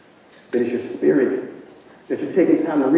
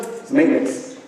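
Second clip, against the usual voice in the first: usual speaking voice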